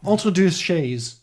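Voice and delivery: slow, deliberate French accent; best French accent. Shown as a demonstration. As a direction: have another careful go at a French accent. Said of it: "let me try my best French accent".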